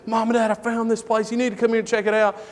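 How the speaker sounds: high, eager retold son's voice; excited kid. Braden to his parents about a place he found, excited. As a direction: imitate an excited boy, rushing to the end. Retold son sharing a find.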